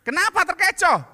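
high, loud baffled question; mock puzzled husband; husband asking. Husband quoted in joke. (suami bingung bertanya dengan suara tinggi dan keras)

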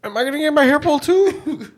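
very high, hammed-up worried voice; mock frightened bystander. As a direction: ask it way up high, as a joke. Joking as the frightened store guy.

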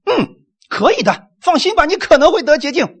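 high-pitched, hedging pretend promise; mock noncommittal reassurance. Imagined Jesus answers a leper asking for cleansing, mock vague reassurance. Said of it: "如果耶稣说".

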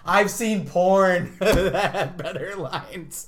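loud, high, slow, talking through laughter; can barely get the joke out for laughing; laughing mockery. Mocking bad movie lines.

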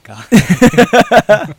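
loud, slow swoon; gushing. Real, not acted. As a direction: swoon over him out loud. Gushing over actor.

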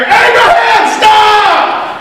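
high, urgent yell; big booming cry at the top of the voice. Retold or imitated. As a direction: shout it to halt someone mid-act. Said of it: "He yelled at him".

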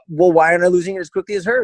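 high, quick, loud mock whine; complaining client. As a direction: imitate a client griping in a high, rushed voice.

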